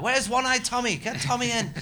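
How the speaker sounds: high, loud pub call across the room. Retold or imitated. Punter calling for a singer, loud and high. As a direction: shout for Tommy over the crowd.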